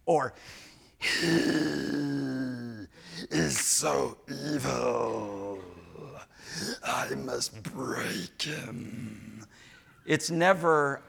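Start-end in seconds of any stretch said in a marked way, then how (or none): 1.0-9.5 slow creepy whisper; mock villain. Pastor mocking a spouse plotting against partner, whispered. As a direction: hiss it like a scheming villain.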